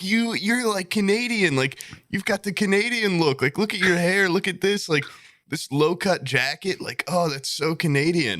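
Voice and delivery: high-pitched excited imitation; mock amazement, pitched well up; gushing stranger impression. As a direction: gush like an enthusiastic new acquaintance. Acts out Canadians gushing at him.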